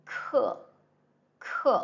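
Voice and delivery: "k" as Mandarin kè, falling fourth tone; fourth tone syllable. Shown as a demonstration. Teacher modelling syllable for learners, crisp falling fourth tone. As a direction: say "k" sharply falling, Chinese kè. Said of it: "it is pronounced as".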